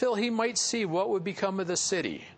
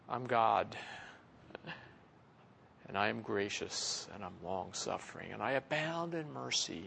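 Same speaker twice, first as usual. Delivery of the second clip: much lower, much softer solemn proclamation; hushed God voice; 9 semitones lower, 9 dB quieter than usual. Retold or imitated. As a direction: say it low and hushed like God's own name. Preacher voicing God proclaiming his name.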